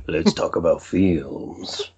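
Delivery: very slow, hammed-up sultry purr; mock sexy voice. Co-host teasing about the morning voice, mock sexy vocal fry. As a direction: drag it out like a joke seducer. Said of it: "like, sexy vocal fry".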